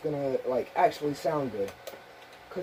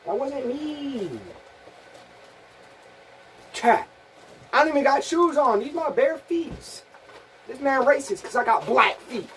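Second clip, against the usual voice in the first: higher, whiny, protesting voice; high defensive put-on voice; 7 semitones higher than usual. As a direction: protest innocence in a silly high voice.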